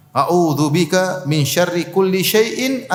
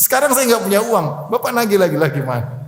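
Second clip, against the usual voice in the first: a raised, mock-offended debtor protesting, higher; indignant debtor; 5 semitones higher than usual. Debtor snapping at the man who came to collect, mock indignant. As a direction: play the debtor who turns the blame on the lender.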